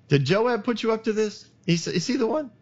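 king teasing out the truth; lightly voiced probing king. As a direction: press her with a hint of amusement. King presses about Joab.

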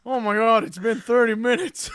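loud, high British grandpa; exasperated old man. Acted. Grandpa still chewing caramel, loudly exasperated. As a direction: wail it loudly in an elderly British voice.

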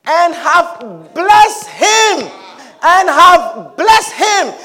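blessed dragged out, loud and slow; slow shouted declaration; slow heavy emphasis. Acted. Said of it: "repeated with slow weight".

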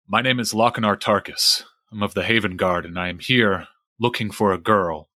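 low, steady guardsman; a formal soldier introducing himself; knight voice. Acted.

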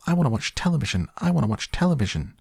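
high, rapid squeaky tantrum; Mike. A narrator's reading. Shrunken boy raging at his father, high squeak. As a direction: squeak the demand over and over, fast. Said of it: "he squeaked".

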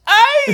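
very high, loud imitated "Ay!" squeal; mock yelp. Imitating prankster as friends pump his chest, mock yelp. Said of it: "With the way he said".

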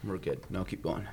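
after a shot of liquor. Real, not acted. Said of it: "hanging on by a very thin thread".

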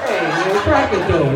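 ordinary talk

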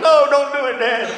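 high mock plea to dad; pleading kids. Kids begging dad not to stop, mock pleading.

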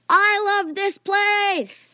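loud, high, drawn-out cheer; overjoyed shout. A narrator's reading. Fan holding conjured kittens, shouting with joy. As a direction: yell it slowly with glee.

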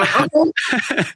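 high, laughing skeptical reply; amused doubt. Retold or imitated. Replying to a friend's generous offer, high amused doubt. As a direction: play the doubt lightly, laughing.

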